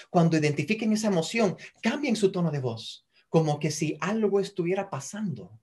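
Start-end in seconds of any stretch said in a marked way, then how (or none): none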